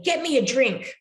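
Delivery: imitating a demanding child. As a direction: bark it like a bossy six-year-old.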